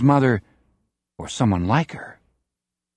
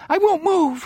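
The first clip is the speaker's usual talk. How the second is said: put-on much higher, panicked gibbering boy. A narrator's reading. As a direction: gibber it in terror. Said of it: "he gibbers".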